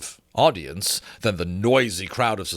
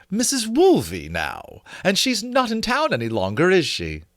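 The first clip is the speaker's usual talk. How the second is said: high, sugary and spiteful; great sweetness; 8 semitones higher than usual. A narrator's reading. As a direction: poison wrapped in sweetness. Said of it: "with great sweetness".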